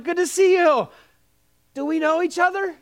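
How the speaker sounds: high, over-friendly retold reply; awkward echo. Pastor bluffing with sample lady, high and awkward. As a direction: echo the greeting high, then ask uncertainly. He echoes her, not recognizing her.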